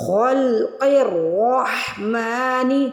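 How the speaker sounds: خلق الرحمن with heavy kha, qaf and ra, very slow; slow tajweed chant; slow heavy recitation. Shown as a demonstration. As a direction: recite it slowly, all three letters full. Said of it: "ہا کی آواز حلق سے نکالیں گے".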